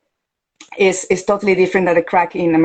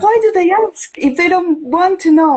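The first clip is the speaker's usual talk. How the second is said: much higher, baffled complaint; exasperated Italian barista; 10 semitones higher than usual. Retold or imitated. Italian barista complaining to her about customers, high baffled exasperation. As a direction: throw up your hands in disbelief. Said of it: "And he said".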